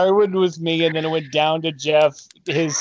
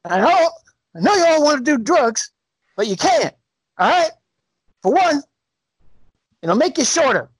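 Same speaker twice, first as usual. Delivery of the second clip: lightly voiced high Kevin Hart, mumbling; a hint of Kevin Hart lecturing in his sleep; Kevin Hart sleep talking; 6 semitones higher than usual. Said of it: "Kevin Hart talking in his sleep".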